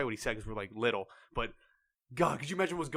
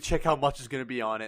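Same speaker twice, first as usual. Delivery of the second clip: ordinary talk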